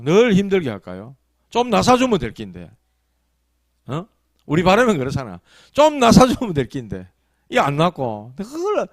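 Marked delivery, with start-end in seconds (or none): 5.7-7.1 higher, louder plaintive wish; voiced complaint of someone long unwell; exasperated wishing. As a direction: press the wish out emphatically. Repeats the sufferer's wish more urgently.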